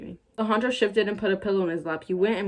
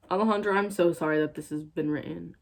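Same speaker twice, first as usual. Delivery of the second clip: ordinary talk, nothing marked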